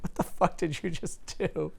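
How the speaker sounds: slow, laughing what-the-hell; laughing disbelief. Retold or imitated. Retelling to a friend how he reacted to the thrown heel, laughing in disbelief. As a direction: laugh through the words in disbelief.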